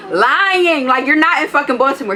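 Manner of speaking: exasperated and loud, calling him a liar; indignant outburst. Real, not acted. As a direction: snap back at the obvious lie. Calling out his lie.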